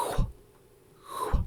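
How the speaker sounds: "h" as a voiceless rush of air, twice; isolated h sound. Shown as a demonstration. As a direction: breathe out each "h" with no vowel. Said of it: "the actual sound is".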